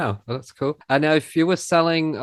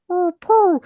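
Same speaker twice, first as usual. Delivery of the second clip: much higher, louder Pooh attempt; failed Pooh voice; 8 dB louder than usual. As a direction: squeak out one more try at the Pooh voice. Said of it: "No, it doesn't sound like Pooh".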